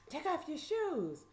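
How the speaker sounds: high, slow command; drawn-out, high-pitched mimicked order; imitated sheriff's order. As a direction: order them about like a mean sheriff. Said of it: "screaming and hollering at us".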